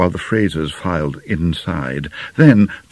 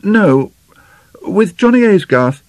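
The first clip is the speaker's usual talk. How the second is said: high, louder answer, feigning nonchalance; studied casualness; 14 semitones higher, 6 dB louder than usual. A narrator's reading. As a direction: drop the name with a little hidden thrill. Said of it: "speak his name so casually".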